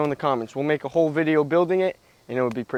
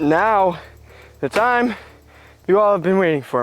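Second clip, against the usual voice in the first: slightly winded talking; out of breath; 4 semitones higher than usual. Real, not acted. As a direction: catch your breath between words. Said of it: "I'm a little out of breath".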